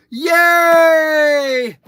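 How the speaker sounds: loud high yay; cheer. Real, not acted. Grandpa cheering that his Mayflower truck arrives today. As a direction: whoop for joy. Happy about his delivery.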